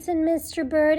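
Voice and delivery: ordinary delivery, nothing marked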